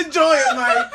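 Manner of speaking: high, loud words through laughter; laughing. Real, not acted. Cohost telling Mike to enjoy it, laughing. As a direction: really laugh while getting it out. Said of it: "she's really laughing at this".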